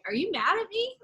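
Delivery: high, sing-song swooping question; mock-timid lilt rising at the end; tentative checking. Acting out asking someone if they're mad, tentative.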